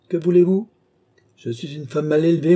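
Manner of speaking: high, loud, unapologetic comeback; defiant retort. Acted. Countess answers the king's reproach, defiant retort.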